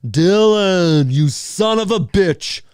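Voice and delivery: loud, high, drawn-out greeting; slow, big action-hero call; hammed-up movie quote. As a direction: boom out the hero's famous line. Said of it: "We're coming up to the".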